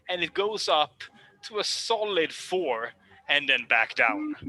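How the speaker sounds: ordinary talk, nothing marked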